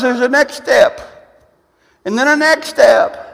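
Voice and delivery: high, loud, emphatic proclamation; full-force preaching. Real, not acted. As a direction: drive each phrase home to the congregation.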